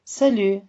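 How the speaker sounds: Salut said saly, u as Turkish ü, t silent; French salut. Shown as a demonstration. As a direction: Salut with rounded ü, no final t. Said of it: "Tekrar ediniz".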